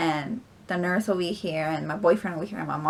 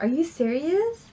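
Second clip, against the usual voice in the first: high, stunned "are you serious"; shocked disbelief; 8 semitones higher than usual. Retold or imitated. Told she's already ten, shocked disbelief. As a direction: gasp it out in disbelief. Shocked at being fully dilated.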